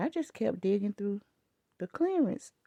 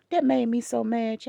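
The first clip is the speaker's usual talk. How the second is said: normal talking voice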